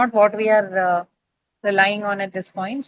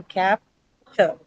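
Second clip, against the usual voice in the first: capture very slowly split as cap, TURE; slow teaching demo of capture, pausing after cap; syllable by syllable; 2 semitones lower than usual. Shown as a demonstration. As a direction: break capture in two, weight on the second syllable. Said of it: "carry the stress on the second syllable".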